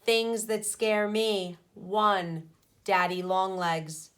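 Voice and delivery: usual speaking voice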